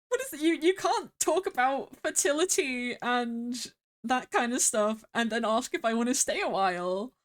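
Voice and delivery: high, incredulous protest; scandalised. Real, not acted. Player objecting to the fae's flirting, scandalised. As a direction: protest in flustered disbelief, pitch jumping up.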